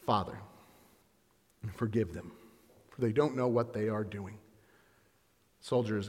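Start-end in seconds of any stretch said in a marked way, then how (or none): 0.1-4.4 quiet prayer. Acted. Jesus on the cross.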